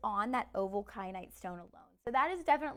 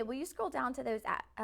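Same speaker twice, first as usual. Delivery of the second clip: normal talking voice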